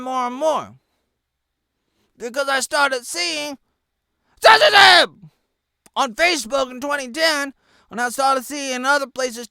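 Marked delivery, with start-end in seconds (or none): 4.4-5.1 yelled much higher and louder; shouted word. Real, not acted. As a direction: spit out the word in anger. Ranting about censorship.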